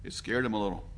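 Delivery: ordinary talk, nothing marked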